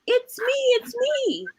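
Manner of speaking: high, slow, sing-song reveal; squeaky little animal cheering it's me. Acted. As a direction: pop out from behind the mask. Hen revealed under mask.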